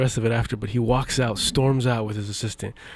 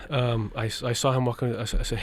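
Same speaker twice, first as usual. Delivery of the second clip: ordinary talk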